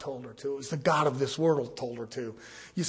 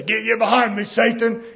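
commanding rebuke; 7 semitones higher, 9 dB louder than usual. Retold or imitated. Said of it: "You say".

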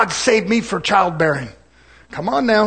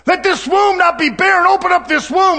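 higher, slow begging plea; pleading woman; 8 semitones higher than usual. Retold or imitated. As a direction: beg the prophet for a child. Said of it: "they pleaded".

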